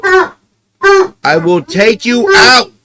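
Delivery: promising the dog a walk, high and drawn out; pet talk. Real, not acted. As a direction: stretch it out sweetly for the dog.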